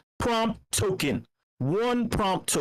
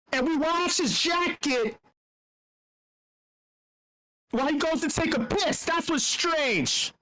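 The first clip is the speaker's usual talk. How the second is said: mock rant; 8 semitones higher than usual. Host mock-rants about the quote.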